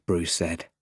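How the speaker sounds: usual speaking voice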